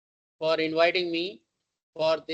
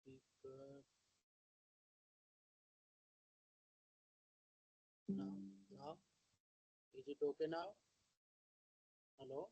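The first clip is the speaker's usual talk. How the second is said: faint voice fading into silence; barely audible; 3 semitones lower, 21 dB quieter than usual. Real, not acted. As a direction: talk far away from the microphone. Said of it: "we are not able to hear you".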